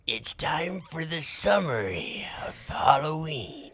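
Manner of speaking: slow, high whispered spooky voice. Shown as a demonstration. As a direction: creep it out slowly in a whisper. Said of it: "Give me a spooky voice".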